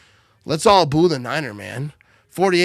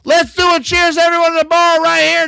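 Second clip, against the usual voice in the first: higher, louder call to the bar; rally everyone for a cheers; excited toast call; 9 semitones higher, 7 dB louder than usual. Real, not acted.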